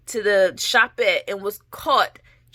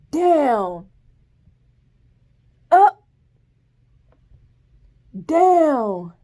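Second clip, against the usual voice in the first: drill commands; 4 semitones higher, 5 dB louder, much slower than usual. Retold or imitated. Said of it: "and they're like".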